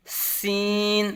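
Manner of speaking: سین with a sharp, whistle-like hissing s, vowel drawn out long; whistling sharp S. Shown as a demonstration. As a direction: hiss the opening of سین like a whistle and stretch it slowly. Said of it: "سیٹی کی طرح تیز آواز نکلے گی".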